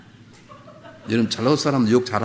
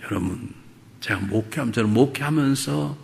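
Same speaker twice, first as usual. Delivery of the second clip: usual speaking voice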